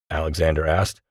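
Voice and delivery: ordinary delivery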